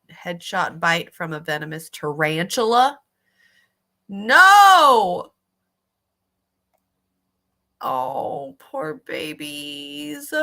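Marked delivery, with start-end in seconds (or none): 4.1-5.3 a loud, high cry of protest; loud shocked no. Real, not acted. To the bitten rats on screen, a loud shocked no. Reacting to rat being bitten. 7.8-10.3 soft pity. Real, not acted. Pitying the rats.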